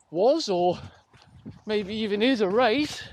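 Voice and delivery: normal talking voice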